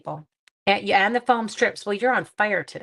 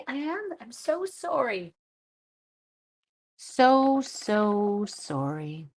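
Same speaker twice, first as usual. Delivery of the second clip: playful exaggerated apology; 3 semitones higher, slower than usual. Crafter apologizing after a giggle, hammed-up sorry. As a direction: stretch out each "so", overdoing the sorry.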